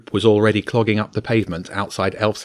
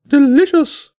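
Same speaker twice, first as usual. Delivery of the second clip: very high, loud bird squawk, savouring; delighted bird voice; 7 dB louder, slower than usual. A narrator's reading. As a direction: squawk with relish like a happy parrot. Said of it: "the bird said".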